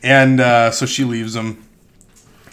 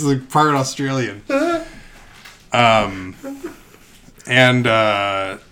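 ordinary talk

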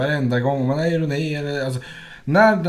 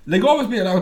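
irritated demand; 8 semitones higher than usual. Retold or imitated. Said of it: "Så hon blir irriterad på spel".

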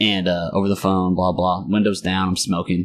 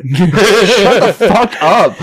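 loud, long-drawn mock yell through laughter; exasperated yell; 9 semitones higher, 6 dB louder, much slower than usual. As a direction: stretch the shut-up into a high holler. Retells shutting stranger up.

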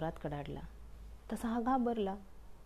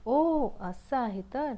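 slow dawning realisation; 4 semitones higher, 5 dB louder, much slower than usual. Acted. Umbrella realising after the forecast.

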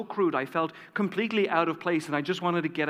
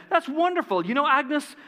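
delighted, much higher voice; bright and smiley; 9 semitones higher than usual. Retold or imitated. Cook's wife hearing of the party plan, bright and delighted. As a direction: gush with joy at the news. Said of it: "all bright and smiley".

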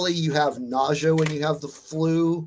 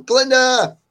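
high, loud call; calling the cat; 10 semitones higher, 9 dB louder than usual. Real, not acted. Streamer calls out to Glinda the cat, loud call. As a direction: sharply raise your voice to the cat.

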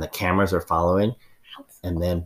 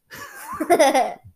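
shrill laugh. Acted. Character's high laugh.

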